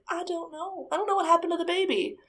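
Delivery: high, hushed then rushed tearful mock sob; imitating crying. Ghost mother telling mediums she doesn't know, high and teary. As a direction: whimper it out, lost and helpless. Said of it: "But she's crying".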